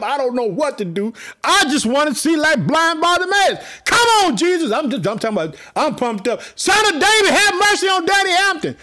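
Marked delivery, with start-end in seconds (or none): 1.4-4.7 preacher pumped up, shouting to Jesus; fired-up shout. Real, not acted. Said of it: "I'm so pumped up". 6.5-8.8 high, loud cry for mercy; preacher crying his own name to Jesus; shouted plea. Real, not acted. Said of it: "I'm not ashamed to say it".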